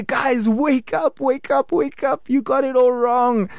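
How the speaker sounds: high, urgent calling out to wake them up; pleading alarm, pitched high; urgent mock warning. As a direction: shake them awake with your voice. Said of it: "I wish I could jump in and just slap the nation Israel".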